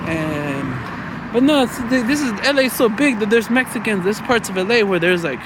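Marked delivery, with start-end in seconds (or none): none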